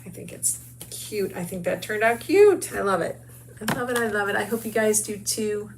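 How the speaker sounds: ordinary talk, nothing marked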